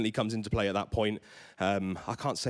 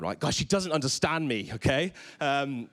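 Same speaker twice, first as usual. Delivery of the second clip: usual speaking voice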